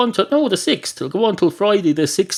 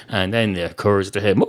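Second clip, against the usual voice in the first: ordinary delivery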